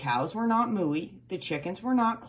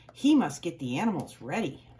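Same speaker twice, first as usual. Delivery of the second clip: ordinary delivery, nothing marked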